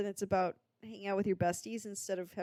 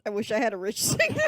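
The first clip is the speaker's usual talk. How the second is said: louder, quick, breaking into real laughter; laughing; 7 dB louder, faster than usual. Real, not acted. Co-host wishes for rich partner, cracking up laughing. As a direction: crack up partway through the phrase.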